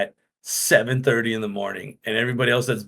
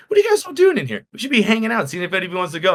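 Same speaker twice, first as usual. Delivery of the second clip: eager pestering; 7 semitones higher, much faster than usual. Retold or imitated. Pestering staff in changing room.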